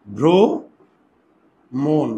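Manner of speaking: "ভ্রমণ" drawn out very slowly as bhro... mon; syllable by syllable. Shown as a demonstration. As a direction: split "ভ্রমণ" with a long gap, closed o in both halves. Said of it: "এখানে দুইটা ওকার আছে".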